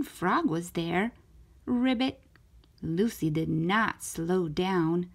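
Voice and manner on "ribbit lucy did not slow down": ordinary delivery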